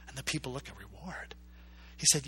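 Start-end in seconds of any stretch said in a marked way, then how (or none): 0.7-1.3 hushed, breathy disbelief; surprised whisper. Retold or imitated. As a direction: whisper it like a stunned crowd. People murmur in surprise.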